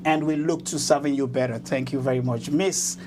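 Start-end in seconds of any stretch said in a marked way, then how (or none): none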